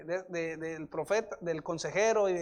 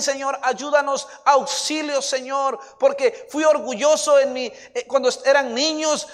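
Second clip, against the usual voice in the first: loud, pleading prayer; impassioned intercession at raised volume; fervent prayer; 4 semitones higher, 11 dB louder than usual. Real, not acted. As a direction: cry out to God, drawing out the pleas. Prayer rising to a fervent plea.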